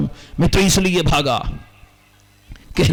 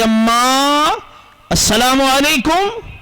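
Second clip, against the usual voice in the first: calling out; 6 semitones higher, slower than usual. Acted. Malik calls through tent curtain.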